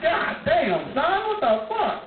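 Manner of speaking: high, slow, drawn-out shock; stunned disbelief. Retold or imitated. Watching a car burn rubber, stunned and high. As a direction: let the pitch climb in amazement.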